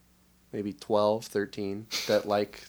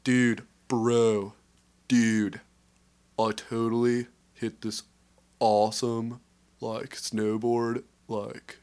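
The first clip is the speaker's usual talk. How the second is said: bro voice; slower than usual. Acted. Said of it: "That's, that's the bro voice".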